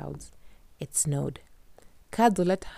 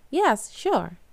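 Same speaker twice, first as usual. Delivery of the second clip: put-on girl voice; 7 semitones higher, 4 dB louder than usual. Kadzo answers.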